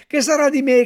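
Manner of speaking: high, loud anguished cry; wailing cry. A narrator's reading. Puppet howling in despair over his friend, high loud cry. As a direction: bawl the question out. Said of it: "continuava a piangere, a berciare".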